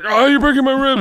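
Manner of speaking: high, loud wail of pain; mock pained cry. Opponent squeezed between his legs, mock pained wail. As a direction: imitate someone crying out while crushed.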